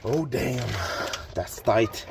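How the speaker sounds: normal talking voice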